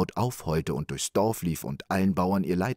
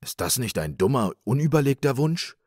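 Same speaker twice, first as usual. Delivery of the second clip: mildly indignant husband, rising at the end; a touch of outrage, louder toward the end; 6 semitones higher, 4 dB louder than usual. A narrator's reading. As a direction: scold her with an exasperated question. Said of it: "rief voll Unwillen der Mann".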